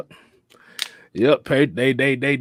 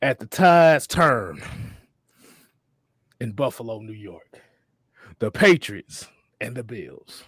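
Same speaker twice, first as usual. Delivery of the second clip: Raven; 3 semitones lower than usual. Acted. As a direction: intone it gravely, phrase by phrase, letting lines drop away. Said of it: "let me do my best Raven impression".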